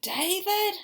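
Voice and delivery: loud high call of David; mother calling out sharply; shouted name. Acted. Mother reading No David, calling David loudly.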